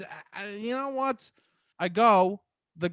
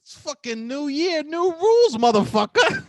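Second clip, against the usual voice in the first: pitched up and loud, shouting new rules; rally the crowd with a New Year cry; hyped rant; 8 semitones higher, 6 dB louder than usual. Real, not acted. Hyping up listeners about New Year rules, loud and excited.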